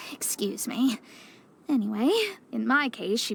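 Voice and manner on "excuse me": quick whispered aside, barely voiced. Real, not acted. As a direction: say it under your breath, as an aside. Aside apologising under breath.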